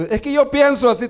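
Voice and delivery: high, smug mimicry of someone trusting their own opinion; mocking a self-sure believer. Preacher mocking a churchgoer who follows his own thinking, high and smug. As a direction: pitch it way up and sound self-satisfied. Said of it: "Tenga cuidado cuando".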